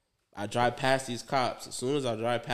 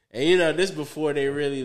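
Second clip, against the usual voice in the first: usual speaking voice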